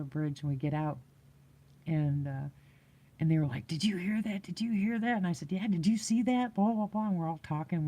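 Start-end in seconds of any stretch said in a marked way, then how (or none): 3.7-5.2 higher-pitched, excited questioning; retold excitement. Friends asking each other after the growl, retold excitement. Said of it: "we're excited". 5.5-7.1 higher-pitched excited chatter; retold excitement. Answering shaken friends by the bridge, retold excitement. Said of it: "we're excited".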